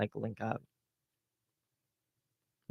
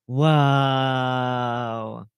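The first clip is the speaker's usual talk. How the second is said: gasp, then a long drawn-out whoa; theatrical gasping wonder; mock awe. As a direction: feign being utterly amazed. Mock amazement at opponent.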